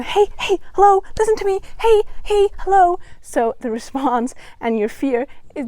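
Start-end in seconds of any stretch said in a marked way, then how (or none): 0.0-3.0 high, loud calling out like an ignored alarm; brain shouting for attention. Retold or imitated. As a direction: yell hey hello, much higher and louder. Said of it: "the brain is gonna".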